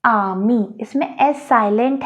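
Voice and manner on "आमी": आमी with the final s silent; French pronunciation. Shown as a demonstration. Teacher demonstrates French amis for the class. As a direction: say आमी, no s sound at the end. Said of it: "पहला example है आमी".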